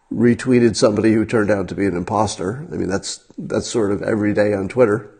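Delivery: ordinary talk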